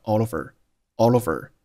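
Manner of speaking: "all over" joined as one word; linked speech. Shown as a demonstration. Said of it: "All和over连读成了all over".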